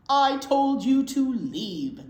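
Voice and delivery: slow, loud angry bear; cried out. Acted. Bear shouting at the mouse to leave. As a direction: tell the mouse off slowly and loudly. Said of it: "cried Bear".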